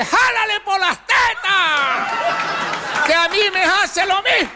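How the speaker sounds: put-on old woman shouting. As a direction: yell from across the yard like an old granny. La vieja grita desde lejos. (grito agudo y lento de viejita desde lejos)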